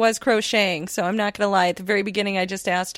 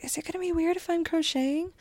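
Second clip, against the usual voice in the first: sheepish question; 7 semitones higher, 8 dB quieter than usual. Retold or imitated. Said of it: "I'm like".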